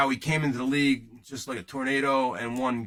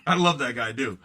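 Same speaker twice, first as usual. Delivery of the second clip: normal talking voice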